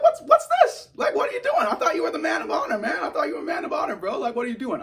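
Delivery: very high, loud, fast indignant whine; high outraged cry. Retold or imitated. Fan scolding Mando for hiding it, high outraged cry. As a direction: call him out, betrayed.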